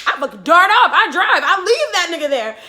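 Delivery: loud, high, laughing retelling; excited laughing. Real, not acted. Telling how she sped away, laughing and loud. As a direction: burst out with it, laughing.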